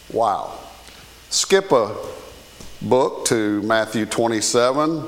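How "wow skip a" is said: ordinary talk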